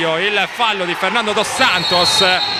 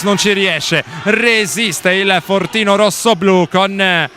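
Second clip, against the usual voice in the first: excited live commentary; 6 semitones higher than usual. Real, not acted. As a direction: build the tension, faster. Frantic final-seconds live commentary.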